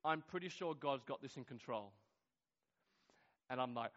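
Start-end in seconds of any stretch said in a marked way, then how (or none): 0.0-1.9 quiet composure, lower than the lines before; very calm. Retold or imitated. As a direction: imitate her answering with unruffled calm. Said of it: "very calmly and she says".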